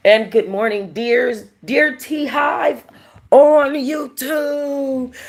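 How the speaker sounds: high drawn-out shout to viewers; loud stream greeting. Real, not acted. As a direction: call it out long and high. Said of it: "calls out to viewers".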